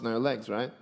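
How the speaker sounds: normal talking voice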